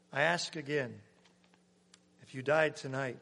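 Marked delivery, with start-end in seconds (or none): none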